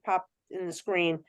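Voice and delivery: normal talking voice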